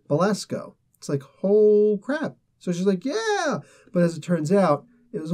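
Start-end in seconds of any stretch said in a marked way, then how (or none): 1.4-2.4 mock amazement. Acted reaction to villain being killed. 3.0-3.6 high-pitched drawn-out celebration; mock cheer. Heroine cheers in retold story.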